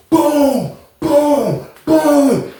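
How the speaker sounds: high, falling booms, one at a time; imitated door kicks. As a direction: mimic repeated kicks on a cell door. Imitates door being kicked.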